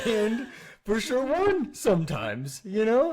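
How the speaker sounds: high, slow sing-song teasing; teasing sarcastic. Pretend. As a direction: tease the wife with a grin. Joking rating of spouse.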